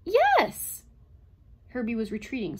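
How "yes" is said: high, loud agitated outburst; distressed robot, voice pitched high; anguished cry. A narrator's reading. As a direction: cry out as if cornered. Cornered robot under relentless pressure.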